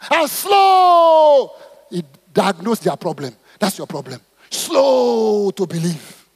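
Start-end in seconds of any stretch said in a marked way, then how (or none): 0.1-1.5 high, loud stretched slow; emphatic shout. Real, not acted. Preacher emphasises the word slow. 4.5-6.3 drawn-out, deliberate slow to believe. Shown as a demonstration. Preacher demonstrates slowness.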